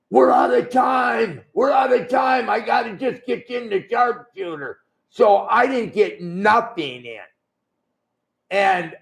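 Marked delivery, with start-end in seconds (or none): 0.1-4.8 mock yell. Wrestler retells Bret yelling mid-match, a higher mock yell. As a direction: shout it like a rushed wrestler in the ring. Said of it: "he yells out".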